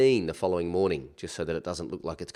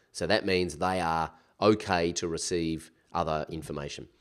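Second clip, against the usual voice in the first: usual speaking voice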